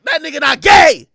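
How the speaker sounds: high, shouted denial; heated contradiction. Retold or imitated. Man contradicting his date's excuse, shouted denial. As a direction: shout her down, incredulous. Arguing back at her.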